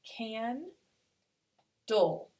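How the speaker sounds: can and dull as two separate beats; syllable by syllable. Shown as a demonstration. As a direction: sound out can... dull, falling on the second. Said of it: "here's my first syllable".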